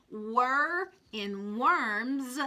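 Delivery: Wor in worms drawn out very slowly; very slow phonics drill. Shown as a demonstration. Teacher modelling wor in worms for children. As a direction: stretch wor for the kids. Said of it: "phonics drill for the class".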